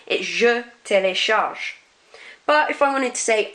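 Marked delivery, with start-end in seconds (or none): 0.2-1.7 corrected "télécharge", ending on ZH; "télécharge" with no vowel at the end; silent final E. Shown as a demonstration. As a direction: slowly, stop "télécharge" on the consonant. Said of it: "not pronouncing that E at the end".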